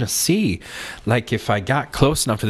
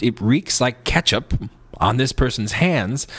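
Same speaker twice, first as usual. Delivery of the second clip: ordinary talk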